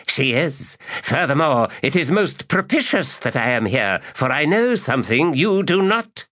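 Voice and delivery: high, smug and sly; smug old magus. Acted. Magus boasting to the lady, high and smug. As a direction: tease them with a secret.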